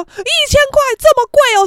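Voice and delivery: high, loud protest at the price; mock scandalised question; imitated indignant disbelief. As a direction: balk loudly, as if being fleeced. Other party balking at price.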